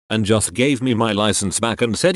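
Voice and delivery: ordinary delivery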